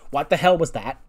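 mildly angry retold outburst. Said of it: "she's all angry".

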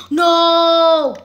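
sharp loud no; shout of alarm. Real, not acted. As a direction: yell it as the danger hits. Sudden danger in game.

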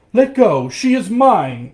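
loud, high possessive shout; fierce shout, last words drawn out; angry shout. A narrator's reading. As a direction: shout it as in a tug-of-war. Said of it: "shouted the professor".

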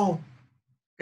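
ordinary talk, nothing marked

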